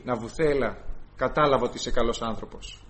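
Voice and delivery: low, soft honeyed flattery; sly flattering queen. Retold or imitated. The queen quietly flatters Naboth to trap him, low and soft. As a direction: lure him in with a hushed sweet voice. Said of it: "Και του λέει".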